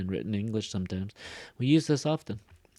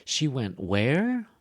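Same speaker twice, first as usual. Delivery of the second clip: drawn-out "where" swooping up; slow echo question, high rise on "where"; surprised tag question; 3 semitones higher, 4 dB louder, slower than usual. Shown as a demonstration. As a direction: stretch "where" upward in disbelief. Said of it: "like, showing surprise".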